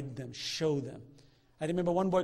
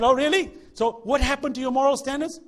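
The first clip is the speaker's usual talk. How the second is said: mock outrage; 8 semitones higher, 11 dB louder than usual. Retorting to the liberal parents.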